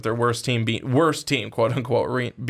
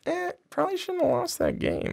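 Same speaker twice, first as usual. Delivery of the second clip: dismissive shrug; 5 dB quieter than usual. Retold or imitated. Mock fan shrugging off a loss.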